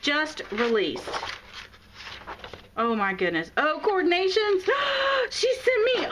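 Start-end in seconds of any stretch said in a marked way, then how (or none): 3.6-6.1 excited squeal. Real, not acted. Opening a friend's gift box, squealing with delight.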